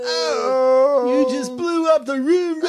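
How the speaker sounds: high, whooping cry of being fooled; shouting delight. Real, not acted. Co-host just got pranked, shouting in delight. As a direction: yell it out, floored by the joke.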